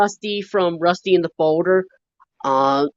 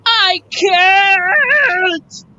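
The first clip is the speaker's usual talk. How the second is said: high, loud crying yell; mock shouted cry; 13 semitones higher, 4 dB louder than usual. Kid imitating Cranky, loud high wail. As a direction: wail it like a stuck crane. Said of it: "when he called".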